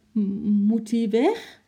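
put-on a small, frightened, stammering child's voice, high and slow; small trembling voice. A narrator's reading. Girl asking her mother fearfully whether the monkey must go, stammering slowly. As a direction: hesitate on the first sound, scared of the answer. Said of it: "met een klein angstig bevend stemmetje".

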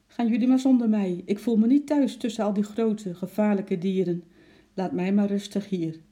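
a shy pet begging to stay home; timid little squirrel. A narrator's reading. Squirrel telling the girl to go without him. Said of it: "alsof hij zeggen wilde".

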